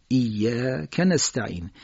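ایاک held on long ya, short ka joined to نستعین; short joined vowel. Shown as a demonstration. Teacher modelling correct recitation, joined syllables. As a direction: slowly lean on iyya, then kanasta'in in ایاک نستعین. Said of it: "اینجا صدا تکیه قرار بدید".

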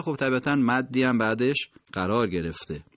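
ordinary talk, nothing marked

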